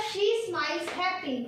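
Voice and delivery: each word read out slowly for the class; slow, careful reading of the example; slow dictation. Shown as a demonstration. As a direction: spell the sentence out word by word. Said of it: "तो first question है हमारा".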